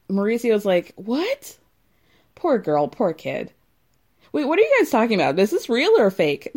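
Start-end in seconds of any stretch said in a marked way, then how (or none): 1.0-1.6 high rising mock-puzzled what; mock bewilderment. Mauricio hearing about car flipping, mock bewilderment. Acts Mauricio's clueless reaction. 4.3-6.5 fast, high-pitched baffled questioning; mock confusion. High Mauricio asking if this is real, mock confusion.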